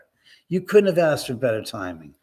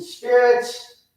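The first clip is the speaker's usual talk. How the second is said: high, louder summoning call; calling to spirits; 9 semitones higher, 5 dB louder than usual. Retold or imitated. Investigator addressing the spirits at the stairs, calling out. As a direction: pitch it up to reach the spirits.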